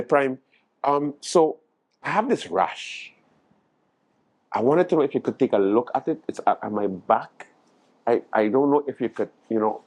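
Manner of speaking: low, hesitant confession of a rash; sheepish patient. Retold or imitated. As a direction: mumble it shyly to the doctor. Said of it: "I say".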